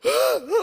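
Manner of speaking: loud high gasping sob; imitating gasping sob. Father imitating son's frantic gasping cry. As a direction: mimic a panicked child's cry. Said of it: "이렇게 우는 스타일인데 막".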